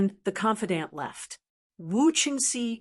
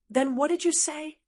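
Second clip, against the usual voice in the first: sweet; 7 semitones higher than usual. A narrator's reading. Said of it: "she asked sweetly".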